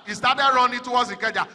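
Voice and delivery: ordinary delivery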